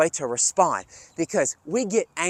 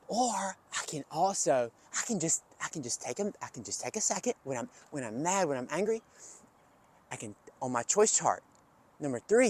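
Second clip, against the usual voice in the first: soft, near-whispered calming talk; hushed calm; 7 dB quieter than usual. Shown as a demonstration. Coach quietly explains taking a second when angry, hushed. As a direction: bring it down to a hush.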